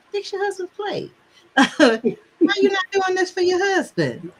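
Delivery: high mock nagging with a laugh; nagging mother. Mom scolding daughter over husband's plate, high mock nagging. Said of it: "she'd be like".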